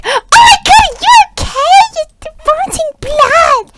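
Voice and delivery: high, loud panicked cry; mock alarm. Onlookers reacting to a man vomiting blood, mock alarm. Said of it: "everyone's like".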